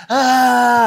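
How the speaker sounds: mock scream half-laughing; imitated panicked cry. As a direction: voice the victim yelling as the sled bounces. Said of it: "o cara".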